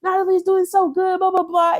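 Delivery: high, excited mock commentator voice; mocking hype commentators. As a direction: parody the fans cheering Natalie on. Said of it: "and they're like".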